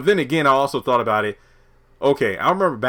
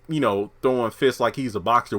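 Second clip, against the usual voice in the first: ordinary delivery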